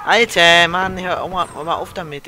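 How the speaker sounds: loud exasperated shout; frustrated yell. Real, not acted. Player yelling at game death.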